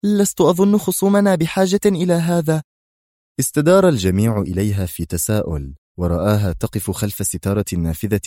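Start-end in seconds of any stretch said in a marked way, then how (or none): 0.0-2.6 high, loud, stern warning; sudden sternness. A narrator's reading. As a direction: cut in sternly. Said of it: "قالت ريهام بصرامة مفاجئة".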